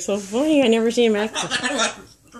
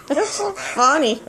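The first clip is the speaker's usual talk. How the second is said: slow, laughing, pitched up; 7 semitones higher, slower than usual. Real, not acted. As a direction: giggle through it. Laughing at bird.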